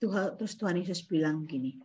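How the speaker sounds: ordinary talk, nothing marked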